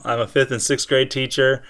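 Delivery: ordinary talk, nothing marked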